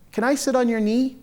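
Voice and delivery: a hint of a shy little girl asking. Little girl asks to sit.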